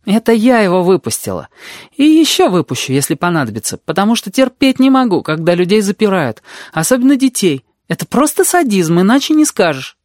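put-on high-pitched, emphatic girl; red-haired girl, indignant. A narrator's reading. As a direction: declare it high, with proud indignation.

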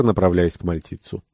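usual speaking voice

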